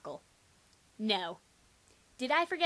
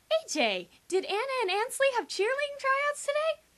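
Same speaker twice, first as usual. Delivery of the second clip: much higher, bright assistant voice; sweet Southern assistant; 3 dB louder than usual. Acted. Assistant Laura asking the narrator, high and sweet.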